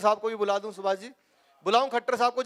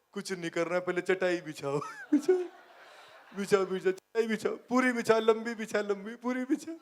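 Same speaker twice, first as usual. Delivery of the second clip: Baba Ramdev impression. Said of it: "Baba Ramdev".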